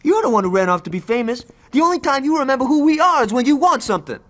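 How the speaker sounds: high, loud, hurt shouting; furious shouting. Acted. Johnny screaming back at Aisha in a bitter fight, furious. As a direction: blow up at her and keep yelling.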